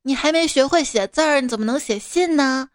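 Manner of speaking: ordinary delivery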